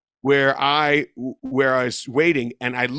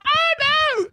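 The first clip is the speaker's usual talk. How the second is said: very high squealing dismay; mock squeal. Ladies on the patio panic at the rat, high squeal. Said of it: "they all were like".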